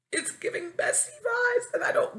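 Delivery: very high complaining cry; exasperated squeal. Real, not acted. Venting about being treated as a bestie, exasperated squeal. Wails the repeat.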